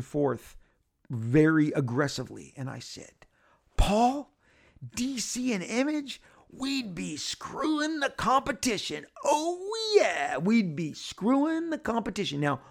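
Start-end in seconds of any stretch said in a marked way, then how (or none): 3.8-6.2 much higher, slow and hammed-up; overexcited pitch. Rob on the ottoman pitching Paul Levitz, hammed-up excitement. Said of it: "but extremely excited". 6.5-12.4 much higher and slow, laughing through it; giddy overexcited. Retold or imitated. Rob thrusting on the ottoman at the DC meeting, giddy crowing. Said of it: "but extremely excited".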